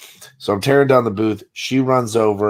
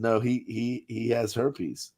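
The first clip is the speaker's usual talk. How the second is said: hushed deadpan; 11 dB quieter than usual. Retold or imitated. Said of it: "And I said".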